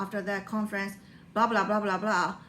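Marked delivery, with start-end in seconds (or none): none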